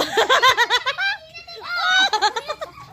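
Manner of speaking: high real laugh. Real, not acted. Laughing at a missed tag.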